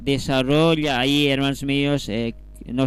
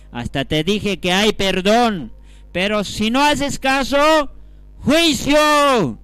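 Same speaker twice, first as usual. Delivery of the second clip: escalating pulpit shout, higher and louder; rising warning cry; 9 semitones higher, 6 dB louder, slower than usual. Acted. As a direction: build from raised voice to a high shout. Preacher voices God's final warning.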